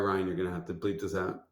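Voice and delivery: usual speaking voice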